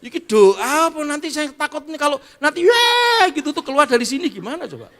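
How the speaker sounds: high, loud wailing plea to God; mock desperate prayer. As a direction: cry out in hammed-up desperation. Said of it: "harus doa desperate pray".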